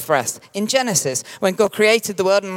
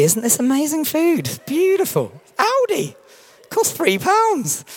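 squealing, high mock delight over cheap food; mock food rapture; 12 semitones higher, slower than usual. Pastor mocking his own date-night food raptures. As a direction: gush like it's fine dining.

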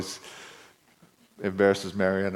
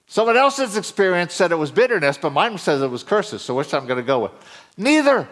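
raised, emphatic preacher voice; preaching; 9 semitones higher, 7 dB louder than usual. Real, not acted. Preacher warning the congregation against relying on experience, emphatic preaching. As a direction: preach it with pitch climbing on key words.

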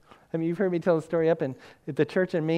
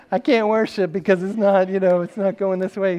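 loud, raised mock complaint; upset churchgoer; 4 semitones higher, 9 dB louder than usual. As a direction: protest like an offended churchgoer.

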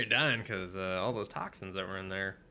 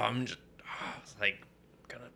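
ordinary delivery

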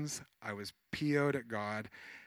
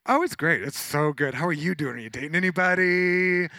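hammed-up loud, high gushing; mock-cheery, high and loud; over-bright deflection; 5 semitones higher, 8 dB louder than usual. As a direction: gush loudly to dodge the question. Acted nervous deflection to client's question.

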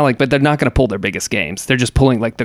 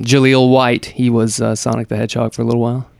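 ordinary talk, nothing marked

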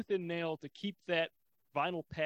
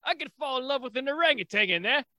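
loud, higher mock Cockney British accent; 7 semitones higher, 7 dB louder than usual. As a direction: bark it like a rowdy British lad. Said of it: "probably the British version".

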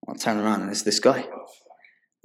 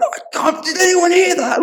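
much higher, louder shrill outcry; shocked wife; 7 dB louder than usual. Retold or imitated. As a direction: cry out like a scandalised wife. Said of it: "his wife went".

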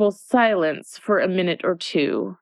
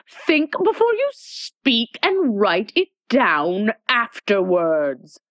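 imperious Red Queen; 4 semitones higher, slower than usual. Acted.

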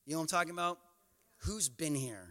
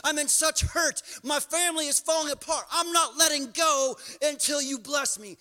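desperate pleading cry; 7 semitones higher, 7 dB louder than usual. Acted. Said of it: "you're saying".

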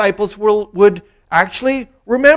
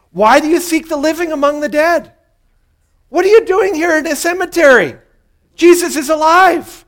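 rebuking angels; 8 semitones higher, 5 dB louder than usual. Acted. Angels rebuke women at tomb.

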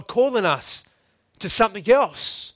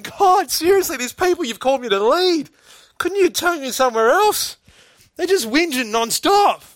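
high, pleading, put-upon complaint; exasperated Moses; 8 semitones higher, 4 dB louder than usual. Retold or imitated. Moses complains to God that the people whinge nonstop, exasperated and high. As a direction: whine to God about his people. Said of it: "Moses is there saying".

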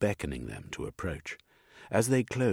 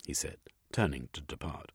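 plain reading voice

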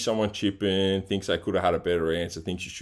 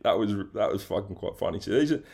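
ordinary delivery

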